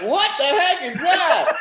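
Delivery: high, loud and slow, laughing through it; laughing disbelief. Retold or imitated. Reacting to Hunter's bit, laughing incredulously. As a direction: crack up while asking what that was.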